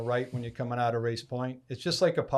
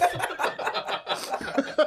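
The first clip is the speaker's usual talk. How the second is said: laughing; 14 semitones higher than usual. Real, not acted. Laugh after the quote.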